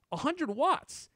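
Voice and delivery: high, thrilled exclamation; amazed at the wattage; excited. Real, not acted. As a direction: sell the big number with a jump in pitch. Excited reveal of charger specs.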